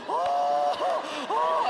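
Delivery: high frantic yelps of fright; panicked screams. Retold or imitated. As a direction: shriek like a car just missed you. Near miss by a car, screaming.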